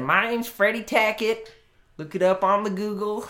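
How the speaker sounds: high, loud Southern character voice; Hound Dog's Southern accent. Retold or imitated. Storyteller imitating customer claiming to be a musician, Southern accent. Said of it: "he'd talk with a Southern accent".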